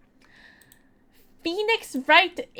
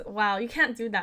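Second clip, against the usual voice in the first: ordinary talk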